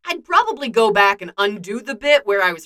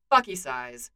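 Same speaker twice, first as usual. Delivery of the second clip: ordinary delivery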